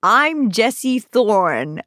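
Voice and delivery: very slow, drawn-out host introduction; game show host voice. Retold or imitated. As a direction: introduce yourself like a hammy quizmaster. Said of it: "Monty Python game show host voice".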